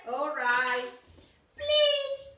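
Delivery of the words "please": high, pleading tiny goat; little goat voice. Acted. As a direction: beg in a small high voice. Small goat begs again.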